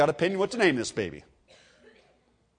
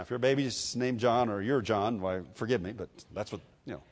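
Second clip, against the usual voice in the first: ordinary delivery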